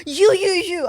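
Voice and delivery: put-on high, loud drunken "you, you, you"; drunk calling out. Comedian imitates drunk fan at the bar, loud and high. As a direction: call out like a pissed bloke at the bar.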